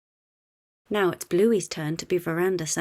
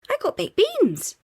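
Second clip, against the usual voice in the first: excited; 14 semitones higher, 6 dB louder than usual. A narrator's reading. Excited kid.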